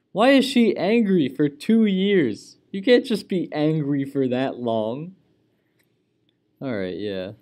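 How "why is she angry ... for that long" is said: exasperated. Real, not acted. Wife character stays angry.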